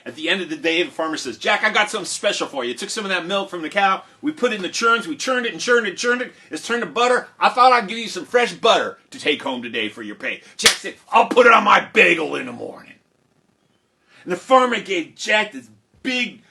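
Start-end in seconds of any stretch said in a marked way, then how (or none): 1.4-10.4 higher, lively farmer patter; enthusiastic farmer. Acted. Farmer offering Jack butter as pay, enthusiastic. 11.1-12.9 loud, higher delighted burst; eager reply. Acted. Jack gladly accepting the farmer's butter, eager.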